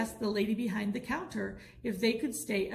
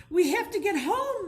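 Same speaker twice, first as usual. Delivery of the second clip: high, loud urgent cry; shout; 10 semitones higher, 7 dB louder than usual. A narrator's reading. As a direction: yell it in a rush to get home. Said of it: "Miles shouted".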